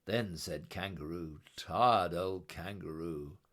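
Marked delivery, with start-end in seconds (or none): none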